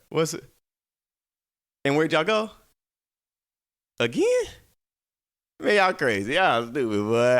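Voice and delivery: ordinary talk, nothing marked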